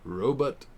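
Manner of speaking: put-on "Robot" said with a rising, drawn-out old-fashioned "ro-bot"; mimicking how "Robot" is said in the old episode, a quirky rising "Robot"; old-time "robot". Shown as a demonstration. Mocking the old show's way of saying robot, with a rising "Robot". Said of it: "They all said robot".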